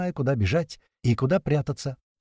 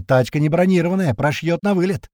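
shout; 5 semitones higher, 5 dB louder than usual. A narrator's reading. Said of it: "кричит мне маленький".

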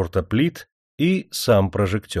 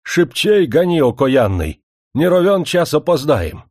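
hurried shout; 6 semitones higher, 5 dB louder than usual. A narrator's reading. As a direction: shout at the driver, high and loud. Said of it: "в запальчивости кричал".

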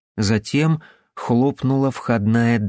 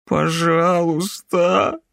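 high, slow whimpering plea; 6 semitones higher, much slower than usual. Acted. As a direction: whimper it like a scared child. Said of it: "захныкал он".

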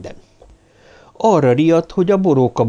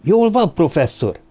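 mildly breathless, raised-pitch question; lightly acted panting concern; 7 semitones higher than usual. A narrator's reading. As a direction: ask urgently, a touch out of breath. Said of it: "lihegte a képébe Cartwright".

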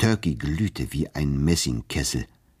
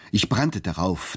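usual speaking voice

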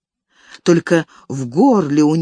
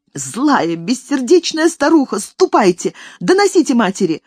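angry dismissal; 6 semitones higher than usual. Acted. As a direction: drive her away in anger. Elis drives the nanny away.

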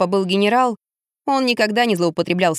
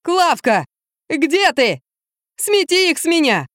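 high, frantic acted yelling; panicked cries; 8 semitones higher, slower than usual. A narrator's reading. Drunk man rolls on the floor calling Klavka, frantic cries. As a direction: cry out for rescue, pitch climbing. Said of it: "и выкрикивая".

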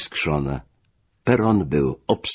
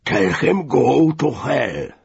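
slow, high angry shout; raised-pitch, drawn-out outburst of fury; furious call; 9 semitones higher, 4 dB louder, much slower than usual. A narrator's reading. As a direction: call it out angrily, drawing out each word. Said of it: "zawołał czerwony już z wściekłości".

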